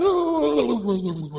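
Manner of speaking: dithering noise that tumbles down and fades; confused wavering ooh. Acted. Umpire torn between two sides, dithering wail.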